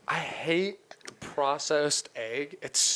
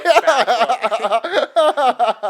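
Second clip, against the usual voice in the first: higher, louder, laughing outburst; imitating a sketch voice; 8 semitones higher, 10 dB louder than usual. Retelling a Key and Peele sketch, loud laughing mock voice. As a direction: blurt it like a cartoon jerk. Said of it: "He's like".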